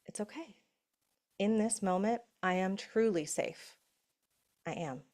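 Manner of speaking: calm the body down gently; soothing self-talk. Shown as a demonstration. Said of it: "We get to tell our body".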